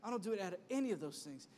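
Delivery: ordinary talk, nothing marked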